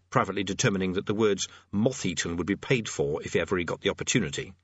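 ordinary delivery

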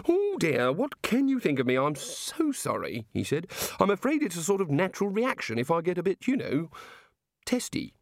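hint of a curious, prim deity; the god. A narrator's reading. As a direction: play the fussy island god, measured. God character voiced in reading.

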